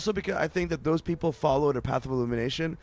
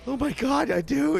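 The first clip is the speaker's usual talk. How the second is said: much higher, astonished; amazed; 11 semitones higher than usual. Retold or imitated. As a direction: gasp it out in disbelief. Recognising his lookalike.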